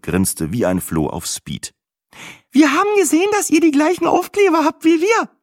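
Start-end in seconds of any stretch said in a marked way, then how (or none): 2.5-5.3 very high, chattering excited woman. A narrator's reading. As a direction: babble it out like a flustered hen. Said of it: "schnatterte sie aufgeregt".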